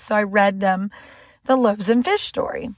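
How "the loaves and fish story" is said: ordinary talk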